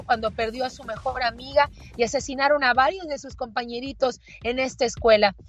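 ordinary delivery

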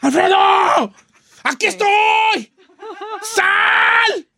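shouting from outside. Pretend. Man shouting a challenge at Alfredo from outside. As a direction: yell up at the building, daring him out. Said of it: "y le grito así de afuera".

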